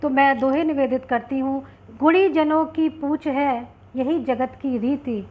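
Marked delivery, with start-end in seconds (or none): 2.0-5.3 slow, raised-pitch couplet recital; poem recitation. Acted. Poet presents a doha to online audience, reciting slowly. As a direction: declaim the doha slowly, each line falling at its end.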